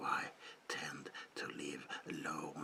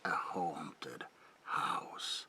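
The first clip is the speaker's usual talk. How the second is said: ordinary talk, nothing marked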